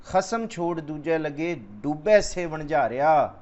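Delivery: slow, measured recital of the verse; scripture recitation. Acted. Preacher reciting gurbani to the congregation, slow and measured. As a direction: draw each word out like sacred text.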